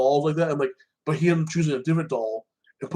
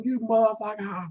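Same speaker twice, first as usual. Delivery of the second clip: retold scream; 8 semitones higher than usual. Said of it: "You see Chucky's wife screaming".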